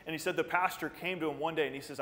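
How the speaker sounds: ordinary talk, nothing marked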